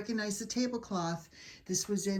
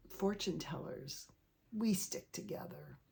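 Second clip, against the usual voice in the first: much softer conspiratorial murmur; hushed aside; 10 dB quieter than usual. Acted. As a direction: lean in and share a secret.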